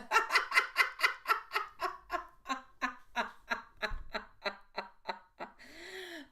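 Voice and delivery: a long, high laugh that trails off. Real, not acted. Reader laughing at her own slip to viewers, long laugh. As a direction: break into real, helpless laughter.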